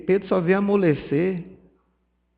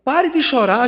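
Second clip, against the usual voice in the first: loud, higher scolding of the mourners; retold stern rebuke, raised high and loud; scolding shout; 7 semitones higher, 6 dB louder than usual. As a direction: bark it at the crying family. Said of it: "Aí ele chegou no meio do velório e disse".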